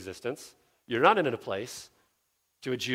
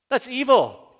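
mock outrage; 9 semitones higher, 5 dB louder than usual. Mocking the objector's outrage.

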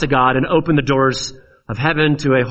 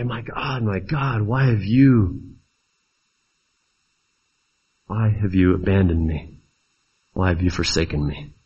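low, hushed, halting lament; a quiet cry of abandonment, sinking lower; forsaken plea; 6 semitones lower, 4 dB quieter than usual. Acted. Jesus on the cross asking God why, forsaken and subdued. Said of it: "Jesus is gonna say".

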